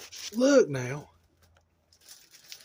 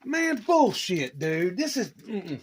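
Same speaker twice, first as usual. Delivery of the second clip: mock outrage; 5 semitones higher, 3 dB louder, slower than usual. Mock outrage at friend's truck.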